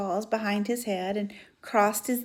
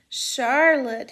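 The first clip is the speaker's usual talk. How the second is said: moaning; 4 semitones higher, 7 dB louder than usual. Acted. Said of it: "he moaned".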